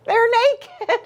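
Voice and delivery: very high, tickled squeak; giggly glee. A narrator's reading. Jilly Boo delivering the naughty word, high and giggly.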